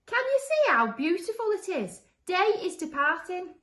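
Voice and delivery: high, loud monster voice; high-pitched monster shouting through a hole; monster calling out. Acted. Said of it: "called through the hole".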